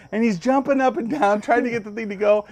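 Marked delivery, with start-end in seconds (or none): none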